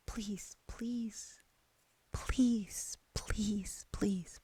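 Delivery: very quiet, voiceless whisper; whispered pleading. Retold or imitated. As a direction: imitate her hushed, repeated begging in a whisper. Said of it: "She's whispering so quietly".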